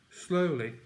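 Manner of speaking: ordinary delivery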